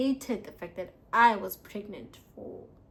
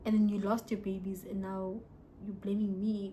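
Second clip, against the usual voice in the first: usual speaking voice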